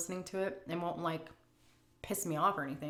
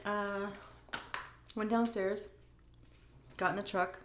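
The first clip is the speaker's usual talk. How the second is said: usual speaking voice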